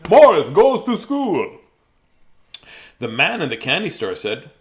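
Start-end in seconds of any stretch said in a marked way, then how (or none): 0.1-1.6 slow, loud campy vampire title. Pretend. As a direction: draw the title out like a Count. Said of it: "doing it in a vampire voice".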